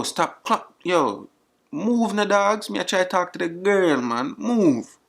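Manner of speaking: ordinary talk, nothing marked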